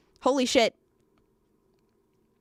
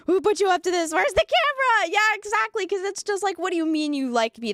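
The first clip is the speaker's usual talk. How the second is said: paranoid accusation; 7 semitones higher than usual. Retold or imitated. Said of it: "acted paranoid reaction".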